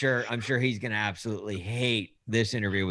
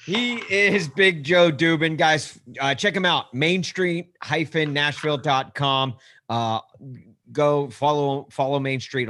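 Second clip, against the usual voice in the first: promo plug; 4 semitones higher, 4 dB louder than usual. Real, not acted. Host plugging guest to listeners, upbeat promo read. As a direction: sell the guest's site like an ad.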